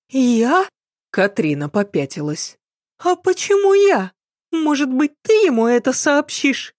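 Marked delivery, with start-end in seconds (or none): 0.1-0.7 high, taken-aback maid voice; startled maid. A narrator's reading. Maid told to wake the master, high startled voice. 3.0-6.7 very high-pitched, balking maid voice; protesting maid. A narrator's reading. Maid refusing to break news to master, high protesting voice.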